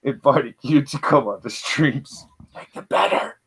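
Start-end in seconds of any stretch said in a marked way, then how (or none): 2.5-3.4 breathy whispered aside; whispered teasing correction. Real, not acted. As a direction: drop to a whisper.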